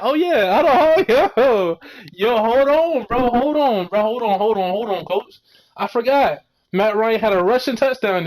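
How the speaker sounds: high, loud, laughing "hold on, hold on"; excited outburst. Real, not acted. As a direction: burst in, suddenly remembering. Excited outburst remembering Matt's touchdown.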